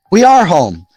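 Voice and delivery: put-on startled boy's exclamation; surprised cry. A narrator's reading. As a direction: realise you're home and cry out. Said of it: "cried Jim, surprised".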